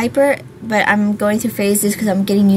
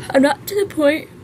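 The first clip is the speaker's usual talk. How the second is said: words stretched by a yawn; 7 semitones higher than usual. Real, not acted. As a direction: talk through a big yawn. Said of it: "ooh, excuse me".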